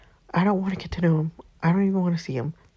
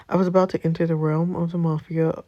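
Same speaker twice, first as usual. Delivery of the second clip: normal talking voice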